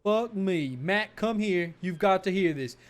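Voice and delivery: usual speaking voice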